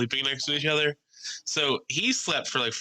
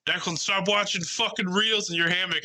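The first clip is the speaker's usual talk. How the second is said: high-pitched imitation of a nagging friend; mock annoyed complaint; 8 semitones higher than usual. Friend telling him off in the hammock, mock annoyed.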